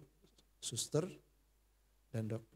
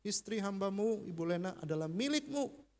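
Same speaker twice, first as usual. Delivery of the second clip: raised, earnest prayer voice; fervent prayer; 5 semitones higher than usual. Retold or imitated. Husband praying over his dying wife, fervent and raised. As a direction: pray aloud at her ear. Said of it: "saya doa di telinganya kenceng-kenceng".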